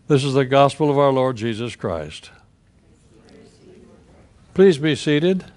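ordinary delivery

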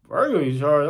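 usual speaking voice

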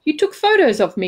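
acted mortified question; indignant disbelief. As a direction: ask in high-pitched, appalled realisation. Indignant question to his rescuer.

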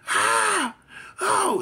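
high-pitched wail, mimicked; imitated mother's holler. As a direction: cry out like a panicked mother. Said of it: "She was hollering".

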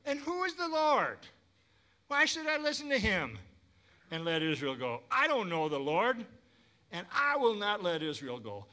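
high, mocking king's voice; sneering Pharaoh. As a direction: scoff at the God of Israel. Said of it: "I picture Pharaoh sneering and mocking".

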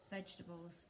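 usual speaking voice

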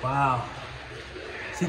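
normal talking voice

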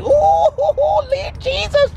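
high hammed-up yelp of pain; cartoonish high cry, slow on the second part; mock burning yelp. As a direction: squeal like hot leather hit bare skin. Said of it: "you be like".

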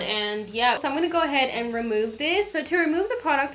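ordinary talk, nothing marked

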